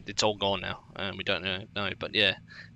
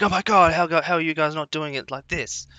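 mock exasperated, high and loud; exasperated viewer; 6 semitones higher, 4 dB louder than usual. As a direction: imitate a know-it-all viewer's disbelief. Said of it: "and be like".

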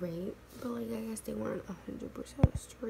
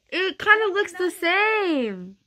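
muffled behind palm; stifled laughing speech; hand over mouth; 9 semitones higher, 15 dB louder, much slower than usual. Real, not acted. Seen 1: Hand covers mouth while she laughs; briefly lowered near 1.3s.

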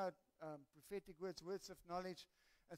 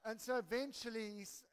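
ordinary talk

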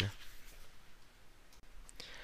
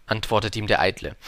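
ordinary delivery

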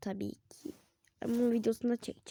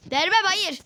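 refusing; 6 semitones higher, 6 dB louder than usual. Real, not acted. Refusing in game.

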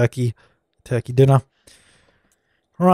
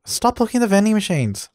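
usual speaking voice

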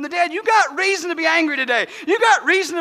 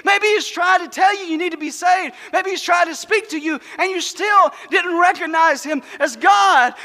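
normal talking voice

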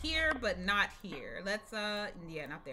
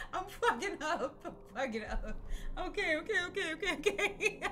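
nervous laughter; 5 semitones higher, 4 dB quieter than usual. Real, not acted. Nervous laugh after mistake.

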